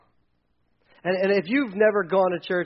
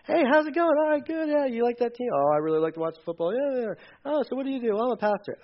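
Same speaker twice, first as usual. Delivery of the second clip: chirpy small talk; 7 semitones higher than usual. Acted. Acted chat with teammate.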